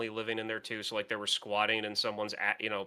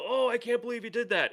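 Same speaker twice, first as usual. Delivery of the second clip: high, fast, gushing fan; imitating a fan gasping at a twist; mock gushing fan; 12 semitones higher, faster than usual. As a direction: rave about the twist, mocking. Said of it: "and I'm just sitting there going".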